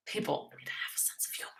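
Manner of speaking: breathy whispered aside after a sigh; a sighing whispered grumble; muttered aside. Real, not acted. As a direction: mutter it under your breath, half to yourself.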